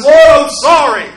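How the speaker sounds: loud, high, pleading cry to God; anguished cry. Retold or imitated. George on the bridge begging God, a loud anguished cry. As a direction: cry out to the Lord in repentance. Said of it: "and says".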